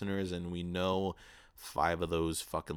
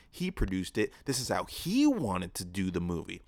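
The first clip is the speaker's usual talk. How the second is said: normal talking voice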